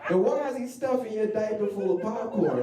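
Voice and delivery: high laughing disbelief; cracking up mid-question, pitched high. Retold or imitated. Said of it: "And I was like".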